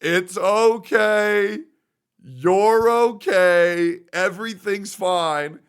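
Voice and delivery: high, slow, soothing reassurance; drawn-out soothing tone, comforting a crying friend; reassuring. Retold or imitated. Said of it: "And I'm like".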